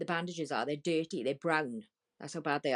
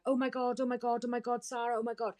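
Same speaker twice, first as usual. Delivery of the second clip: higher-pitched horrified reaction, imitated; retold shocked exclamation; 7 semitones higher than usual. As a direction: repeat it in high, stunned alarm.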